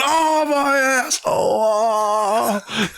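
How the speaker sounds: slow, high, whimpering mock pain; mock pained wail. The loser of the wishbone pull groans in hammed-up pain, high and slow. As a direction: wail it slowly like a hammed-up injured victim. The one left with sore behind.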